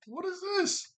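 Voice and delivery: very high, incredulous question; squealed disbelief, played for laughs; mock indignation. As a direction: imitate someone appalled at being interrupted. Acting out Oric's displeasure at intrusion.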